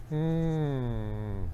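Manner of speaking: soft, drawn-out "mm"; long puckered hum. Retold or imitated. Host admiring the rental girl's pucker, long soft hum. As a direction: hum softly through puckered lips.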